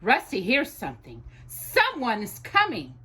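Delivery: ordinary delivery, nothing marked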